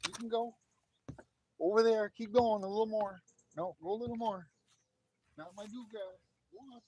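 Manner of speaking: high-pitched talk to a pet; coaxing a dog. Real, not acted. Owner sending the dog over there, high coaxing voice.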